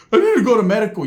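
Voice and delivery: high, loud, hammed-up plea; mock injured man. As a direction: imitate a hurt man's urgent whine.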